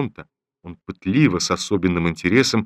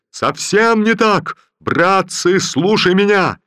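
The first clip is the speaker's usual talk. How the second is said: slow, very high and loud bellow; furious drill shout; 11 semitones higher, 6 dB louder, slower than usual. Acted. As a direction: roar instructions at the bayonet drill. General shouting drill instructions.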